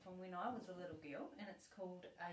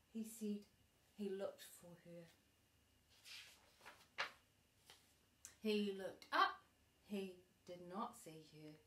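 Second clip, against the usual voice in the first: gentle storybook reading voice; reading to a child; 6 dB louder than usual. Acted. Grandma reading a bedtime book to her granddaughter, gentle storybook voice.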